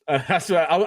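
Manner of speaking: ordinary talk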